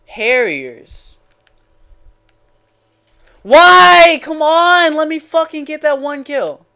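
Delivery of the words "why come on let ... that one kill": high, loud exasperated yell; real gamer rage. Real, not acted. Gamer protesting a failed kill, exasperated yell. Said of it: "after raging that hard".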